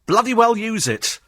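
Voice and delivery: high, sharp telling-off through the window; mock-angry passenger calling through the glass; annoyed shout at cabbie. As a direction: yell at the driver to hurry up. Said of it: "tapped on the window and go".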